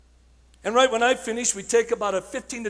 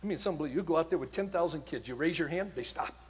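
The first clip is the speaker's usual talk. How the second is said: ordinary talk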